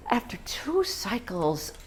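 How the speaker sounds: hushed whisper after a pause. Acted. Confiding to the audience about her IVF cycles, whispered. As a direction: drop to a whisper as if it hurts to say.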